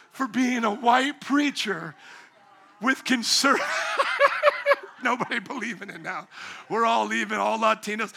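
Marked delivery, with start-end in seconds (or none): 3.6-5.0 high burst of laughter. Real, not acted. Laughs mid-sentence.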